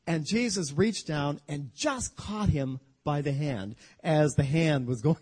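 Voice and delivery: ordinary talk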